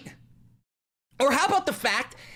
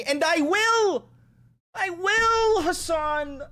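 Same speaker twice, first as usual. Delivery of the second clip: higher, slow, hammed-up eager yes; mock eager shout; 7 semitones higher, much slower than usual. To Hassan, a hammed-up eager promise. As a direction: play up the creepy eagerness. Joking threat after quoted worry.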